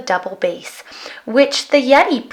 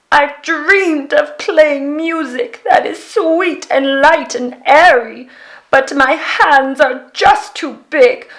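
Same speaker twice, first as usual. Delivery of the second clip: Yeti crying out; 5 semitones higher, 5 dB louder than usual. A narrator's reading. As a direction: moan it like a heartbroken giant. Yeti voice continues.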